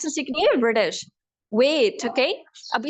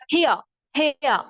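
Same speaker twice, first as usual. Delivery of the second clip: "here" ending in an open "ah", British; British accent. Shown as a demonstration. Teacher converts the word, British accent. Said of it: "they converted into British".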